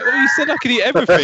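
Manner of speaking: put-on loud, high, fast childish protest; indignant protest. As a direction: protest like a wronged kid, quick and loud.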